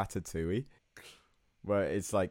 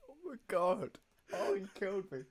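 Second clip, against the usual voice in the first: helpless high-pitched laughter; laughing too hard to speak; hysterical laugh; 12 semitones higher, 4 dB quieter than usual. Real, not acted. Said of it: "I'm crying laughing".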